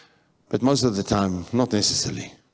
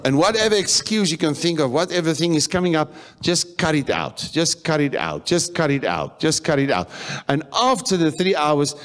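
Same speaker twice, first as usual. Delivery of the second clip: preaching exhortation; 7 semitones higher than usual. Real, not acted.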